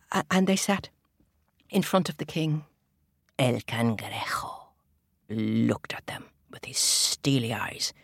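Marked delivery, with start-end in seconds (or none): 3.4-7.9 slow low storyteller whisper. A narrator's reading. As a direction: hush it, slowly and ominously. Ominous narration of the crab.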